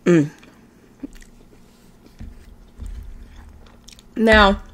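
chewing while talking; talking around food; mouth full. Real, not acted. Seen 0: cheeks full, lips pressed chewing early, mouth clears later.

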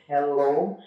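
low, drawn-out phone greeting; Joe Biden. Acted. American president calling at night, lightly imitated. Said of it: "the way they speak English".

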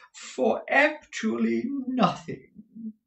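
very slow, high drawl; affected, gushing husband. Acted. Husband confirming the bargain to his wife, high affected drawl. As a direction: stretch each word out in high rapture.

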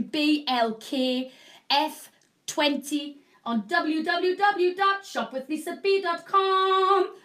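slow, sing-song web address; home shopping host. Real, not acted. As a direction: chant the website like a TV host. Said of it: "reading out promo code and website".

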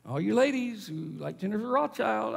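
ordinary delivery

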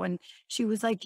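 usual speaking voice

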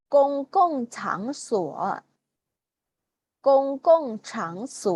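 slow, clear Mandarin drill words said twice each for repeating; teacher's model reading. Shown as a demonstration. Teacher reads vocabulary for students to echo, slow and clear. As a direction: read each word out slowly for the class to repeat. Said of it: "ออกเสียงตามนะคะ".

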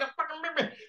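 high-pitched imitation of panicked yelping; frantic mock outrage; Dave Fane. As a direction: squeal it as a bitten man, re-enacted. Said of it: "And Dave's like".

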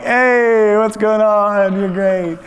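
butter him up before the favor; overly sweet buttering-up hello; flattering friend. Retold or imitated. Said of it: "they're like".

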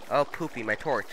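ordinary delivery

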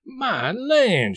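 high, loud happy exclamation; delighted surprise. A narrator's reading. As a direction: burst out in glad surprise. Aunt Sally surprised.

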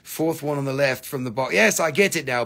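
ordinary talk

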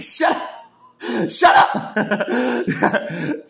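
high, loud, strained "shut up"; frustrated yelling at the commentator; mock shout. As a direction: shout him down, half laughing. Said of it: "I'm like".

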